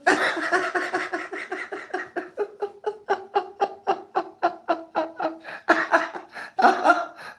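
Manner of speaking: long, high, helpless laughing. Real, not acted. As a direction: lose it laughing.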